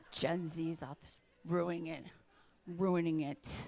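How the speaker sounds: low, slow mock grumble about Gen Z. Comedian grumbling to the crowd about Gen Z, low and slow.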